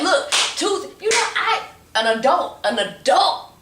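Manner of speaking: high, loud, giddy; excited high voice. Retold or imitated. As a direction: squeal it out with glee. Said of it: "I'm like".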